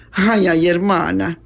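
mock whimpering complaint; a mild acted sob; tearful shriek. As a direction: whine as if crying. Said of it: "Chilla Nieves llorando".

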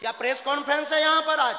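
high, fast indignant question; real angry challenge. Real, not acted. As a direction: fire the question at an intruder. Angrily questioning the reporter.

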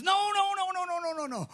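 a string of high, rushed nos; frantic refusal. Retold or imitated. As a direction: wave it off fast and high. Friend refusing to pray, retold.

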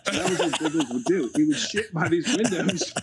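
laughing through the words; cracking up while telling it. Real, not acted. Laughing at his own story.

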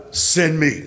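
ordinary talk, nothing marked

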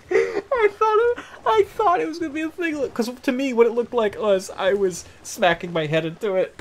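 high-pitched, loud, laughing explanation; cracking up. Real, not acted. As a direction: squeal it through helpless laughter. Cracking up after own funny voice.

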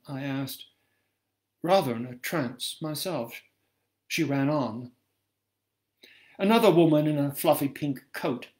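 ordinary delivery, nothing marked

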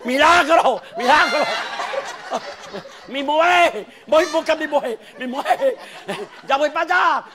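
high, loud joyful cries; excited shouting. Acted. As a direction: yell it like a miracle happened. Man shouting with joy. (gritos de alegría)

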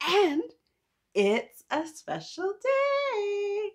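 high, slow and drawn out; sing-song excitement. Real, not acted. Teacher teasing kids about a special day, high drawn-out sing-song.

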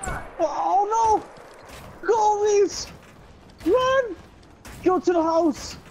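being chased. Real, not acted. Chased near the end.

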